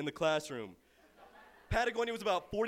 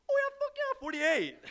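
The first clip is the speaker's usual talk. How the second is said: much higher, louder triumphant squeal; Sugar's gleeful yes; 7 dB louder than usual. Retold or imitated. Poet answering the accent remark, hammed-up triumphant yes. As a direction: throw the hometown slang back proudly. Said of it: "honestly say".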